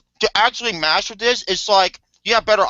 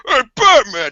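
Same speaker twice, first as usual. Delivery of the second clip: mock taunt; 7 semitones higher, 6 dB louder, slower than usual. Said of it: "the Arkham inmates were like".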